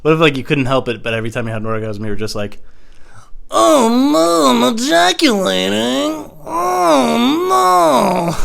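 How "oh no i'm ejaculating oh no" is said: high, loud, drawn-out wail; hammed-up cry of dismay; mock wailing. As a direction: wail helplessly, dragging the words out. Helpless mock wail at climax.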